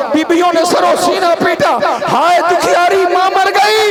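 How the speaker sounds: high, loud, keening cry; the women beat their chests and keen for the mother; wailing lament. Acted. (सीना पीटती औरतों का विलाप)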